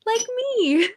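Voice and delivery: playful high quip; joking. Real, not acted. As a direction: tease yourself lightly. Joking about being unstable.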